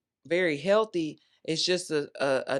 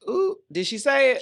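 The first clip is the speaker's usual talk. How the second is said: sassy hype; 9 semitones higher, 4 dB louder than usual. Acted. Hyping her own bold line.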